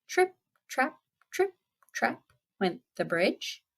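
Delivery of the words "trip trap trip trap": high, light trip-trap; little clipping high-pitched steps; tiny goat footsteps. Acted. Youngest goat crossing the bridge, high and light. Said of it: "came the youngest billy goat Gruff".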